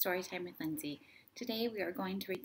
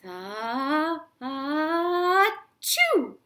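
big building sneeze, higher and louder to the burst; enormous sneeze; 6 semitones higher, 10 dB louder, much slower than usual. Acted. The bear sneezes the animals out of the mitten, huge comic sneeze. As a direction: wind up and explode into the bear's sneeze. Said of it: "gave an enormous sneeze".

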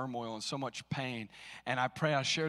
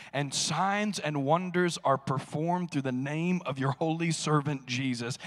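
louder, proclaiming scripture reading; preaching scripture; 6 dB louder than usual. Acted. Pastor reading the Acts prayer to the church, proclaiming loudly. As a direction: read the apostles' prayer with force.

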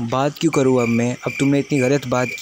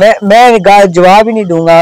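loud, higher fake fury refusing to answer; mock angry refusal; 6 semitones higher, 8 dB louder than usual. Pranking his friend, he fakes a loud angry refusal. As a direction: bark the refusal like you're truly offended.

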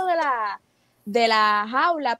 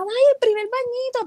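high, gushing coo; cooing over the pet's first little bath, high and excited; 10 semitones higher than usual. Retold or imitated. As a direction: delight over a tiny milestone. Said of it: "Y yo bien emocionada".

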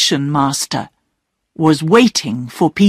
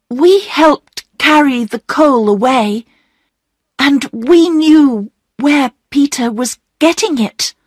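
put-on high-pitched child's confession; young girl owning up; 7 semitones higher than usual. Bobby confessing to the station master, young girl owning up. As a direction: own up in a high girlish voice.

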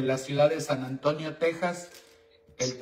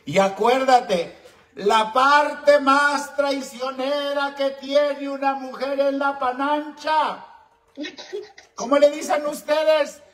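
high, loud, insistent harangue; loud lecturing; 10 semitones higher, 7 dB louder than usual. Real, not acted. Host lecturing caller who thinks of her ex, shouting. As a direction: preach it at full volume.

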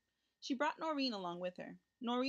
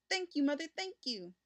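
put-on higher, louder grateful boy; excited thanks; 6 semitones higher, 4 dB louder than usual. A narrator's reading. Richard thanks his mother, higher and louder, excited. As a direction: gush thanks while jumping. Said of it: "cried Richard, jumping up and down".

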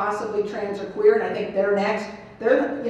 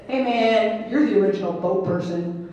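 humorous jibe; 6 dB louder than usual. Retold or imitated. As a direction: build the joke to a loud punchline. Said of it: "he's laughing as he says it".

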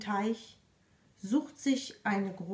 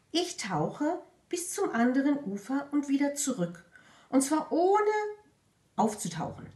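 mouse voice; 6 semitones higher than usual. Acted. Mouse boasts.

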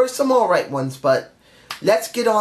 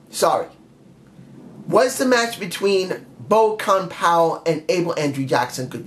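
ordinary delivery

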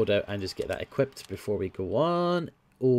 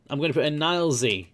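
usual speaking voice

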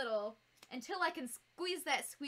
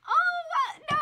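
very high, loud cry of surprise; real squeal of astonishment; shocked disbelief; 12 dB louder than usual. Real, not acted. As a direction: shriek it, overwhelmed by a gift. Overwhelmed by generosity in chat.

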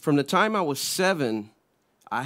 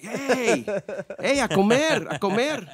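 calling to dinner; 6 semitones higher than usual. Retold or imitated. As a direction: imitate grandfather hollering in Spanish. Grandfather interrupting, calling him to eat.

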